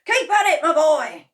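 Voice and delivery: high, loud cheer; excited cry. A narrator's reading. Mister Cranky dancing round the kitchen, cheering loudly. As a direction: egg him on. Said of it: "cried Mister Cranky".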